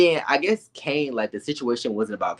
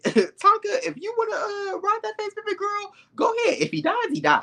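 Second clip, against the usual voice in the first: much higher, cheering a friend on; cheering Tonka on in a high teasing voice; high hype-girl voice. Retold or imitated. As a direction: egg her on in a squeaky mock voice. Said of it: "And I said".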